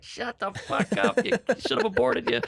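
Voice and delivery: a high slowed-down mock threat; mock hostile hush. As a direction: shut the kid up, hammed up. Hissing at niece to stop.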